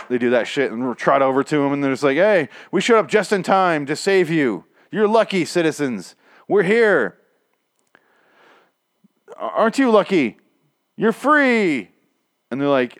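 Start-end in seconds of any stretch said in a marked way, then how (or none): 2.2-11.9 high, ringing mock-heroic announcement; hammy, self-satisfied savior voice; pompous rescuers. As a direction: proclaim your grand rescue. Highwaymen boasting to rescued citizens.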